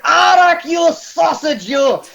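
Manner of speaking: put-on high, loud booming hail to an old friend; hearty greeting. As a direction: shout it like a delighted barkeep. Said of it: "He's like".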